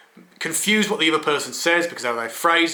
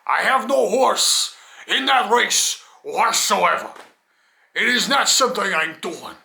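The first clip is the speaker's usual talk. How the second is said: slow, higher, grandly declaimed put-on voice; 5 semitones higher, slower than usual. Man stressing he has no stake in it, put-on voice. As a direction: declaim it slowly in a silly affected voice. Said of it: "why I'm talking in that voice".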